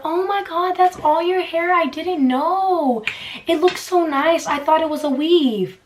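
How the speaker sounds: high, gushing mock amazement; gushing surprised stranger. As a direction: play the wide-eyed stranger who can't believe it's real hair. Mocking people amazed by her hair.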